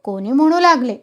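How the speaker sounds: ordinary delivery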